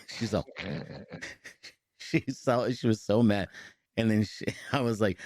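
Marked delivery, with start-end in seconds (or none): none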